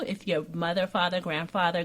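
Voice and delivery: ordinary talk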